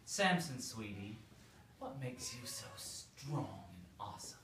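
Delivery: soft, sweet-talking coax. Acted. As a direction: softly flatter him, a touch of syrup. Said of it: "saying sweet things to him".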